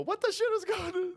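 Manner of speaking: very high-pitched imitation of startled workers; exaggerated falsetto disbelief; mock bewilderment. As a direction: squeal it like a baffled bystander. Voices startled restaurant workers.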